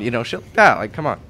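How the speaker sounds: ordinary talk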